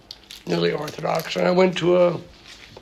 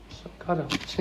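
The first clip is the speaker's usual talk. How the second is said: a soft sigh of a prayer; whispered aside; 8 dB quieter than usual. Real, not acted. Speaker to audience, hushed trailing plea. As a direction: breathe it, almost voiceless. Quiet repeat closing the thought.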